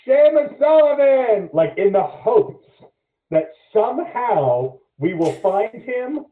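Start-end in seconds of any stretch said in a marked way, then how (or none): 0.0-1.5 high, drawn-out holler across campus; screaming a name. Retold or imitated. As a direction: call out for a lost friend, pitched up and loud. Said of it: "wandering around screaming".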